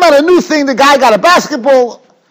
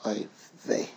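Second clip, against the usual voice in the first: breathy whispered oy vey; whispered groan; 11 semitones lower, 19 dB quieter than usual. Retold or imitated. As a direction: sigh it out under your breath. Groaning at the girl's learning.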